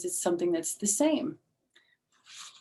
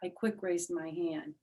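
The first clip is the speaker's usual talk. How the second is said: ordinary delivery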